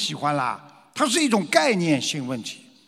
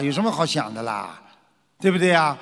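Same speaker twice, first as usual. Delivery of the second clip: usual speaking voice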